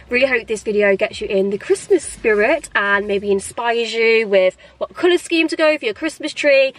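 ordinary talk

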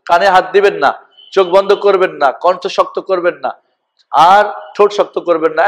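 ordinary delivery

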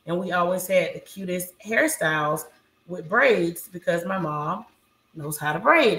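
usual speaking voice

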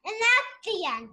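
high, loud announcement; loud, high story sign-off; insistent shout. Real, not acted. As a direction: shout the ending over the teacher. Child shouts the story's ending.

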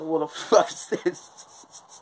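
slow, high, laughing disbelief; retold shock. To his girlfriend at the market, slow laughing disbelief. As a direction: gasp it out through a laugh at the sight. Handed a live chicken, shocked.

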